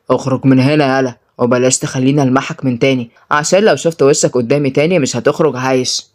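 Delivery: low, menacing boss voice; threatening boss. Acted. Boss throwing a man out, threatening.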